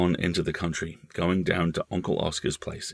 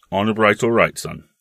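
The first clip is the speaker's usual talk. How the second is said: reassuring uncle; 3 semitones higher, 6 dB louder than usual. Acted. Uncle promising his nephew secrecy, firm and hearty. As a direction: give your word firmly, man to boy.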